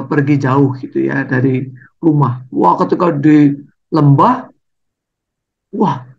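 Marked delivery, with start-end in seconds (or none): none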